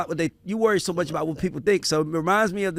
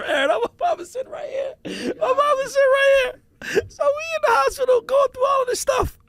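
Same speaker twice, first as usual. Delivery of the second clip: laugh-crying. Real, not acted. Laughing while telling hospital story.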